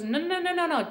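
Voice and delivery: high, insistent mock no no no; insistent mother.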